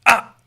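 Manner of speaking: high, loud barked "ah"; sharp correcting "ah". Shown as a demonstration. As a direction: startle the dog. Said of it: "you've gone "ah".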